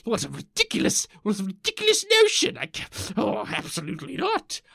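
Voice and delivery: hammed-up scandalised Victorian, leaping high; indignant old-timey Victorian. Old-timey visitor rejecting the idea of an elephant, hammed-up indignation. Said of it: "the old-timey people going like".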